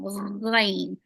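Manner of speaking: gargle the gh at the start of Ghain; gargled ghain. Shown as a demonstration. Teacher demonstrating the gargling letter, throaty. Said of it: "I call it the gargling letter".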